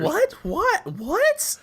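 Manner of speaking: high squeaky repeated disbelief; can't believe what he heard; shocked disbelief. Real, not acted. Reacting to the banned numbers.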